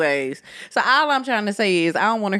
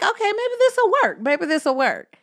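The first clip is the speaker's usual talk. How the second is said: high hopeful inner voice; 10 semitones higher than usual. Retold or imitated. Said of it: "I'm thinking, like".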